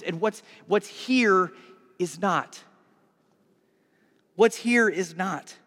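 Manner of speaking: normal talking voice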